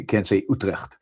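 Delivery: normal talking voice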